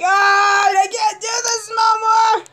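high, slow, drawn-out wail; desperate scream. Real, not acted. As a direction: scream it out at breaking point. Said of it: "screaming the way I am".

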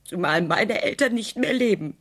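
emotional outburst. Acted. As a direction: let the grief burst out. Emotional reveal about her parents.

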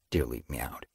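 plain reading voice

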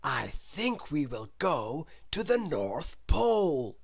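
lightly voiced guide; Mister Daydream. A narrator's reading. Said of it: "said Mister Daydream".

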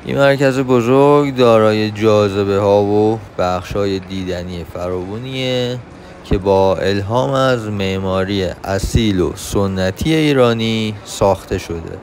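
ordinary talk, nothing marked

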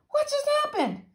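very high, astonished voice; amazed. A narrator's reading. Little Mac after the ghosts vanished, high amazement. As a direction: blurt it in high-pitched wonder.

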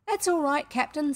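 high, complacent sailor voice. A narrator's reading. Bosun reassures his captain, complacent. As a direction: answer the boss, pleased with yourself. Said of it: "Smee answered complacently".

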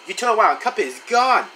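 ordinary delivery

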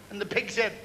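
ordinary delivery

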